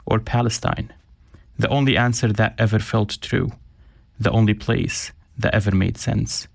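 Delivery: measured, reflective reading aloud; reading his own writing. Acted. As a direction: read it like a personal essay, each place name set apart. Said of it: "thank you so much for reading that".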